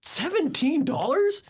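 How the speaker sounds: high, drawn-out questioning disbelief; mock incredulous. Tourist balks at the seller's price, incredulous.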